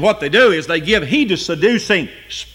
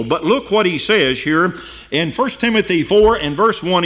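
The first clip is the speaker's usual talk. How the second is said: ordinary talk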